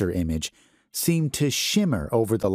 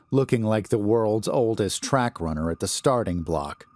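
ordinary delivery

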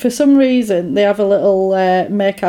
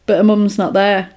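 usual speaking voice

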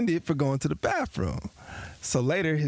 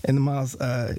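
ordinary delivery

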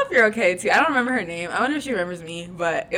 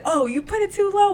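mock high voice; 11 semitones higher, 3 dB louder than usual. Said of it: "She said".

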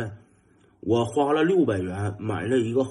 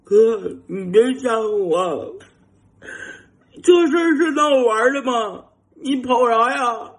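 near tears; 12 semitones higher, 6 dB louder than usual. Retold or imitated. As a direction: whine on the verge of crying. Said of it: "上来之后头要哭了". (被吓坏的弟弟追上来后带着哭腔抱怨)

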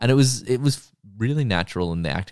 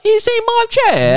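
much higher, louder, slow mock British voice; mock British accent; 8 dB louder than usual. Podcaster jokes the kid's accent will turn British, mock British accent. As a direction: drawl it out in a hammy put-on English accent. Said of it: "he'll just eventually be like".

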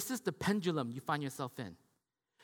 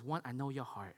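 ordinary talk, nothing marked

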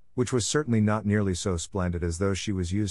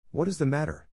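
higher, anxious question; concerned cry; 7 semitones higher than usual. A narrator's reading. Beauty asking her grieving prince, concerned cry. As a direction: ask in lifted concern, lightly acted. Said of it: "she cried".

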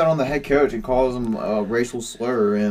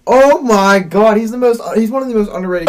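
exasperated; 8 semitones higher, 9 dB louder than usual. Real, not acted. Heated argument over quarterback.

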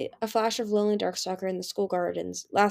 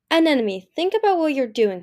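higher, louder strained plea; voice cracking; 5 semitones higher, 6 dB louder than usual. A narrator's reading. Turtle talking his sister down, voice cracking. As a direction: plead with the voice about to break. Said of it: "his voice cracking".

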